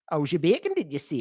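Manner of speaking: high, very loud Geordie catchphrase; Geordie accent. Acted. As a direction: shout it in exaggerated Geordie to goad a friend. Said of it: "in a Geordie accent".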